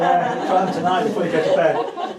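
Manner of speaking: playful dare, laughing aloud. Real, not acted. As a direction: egg them on while laughing. Teasing encouragement amid laughter.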